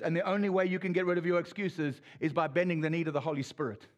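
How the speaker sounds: ordinary talk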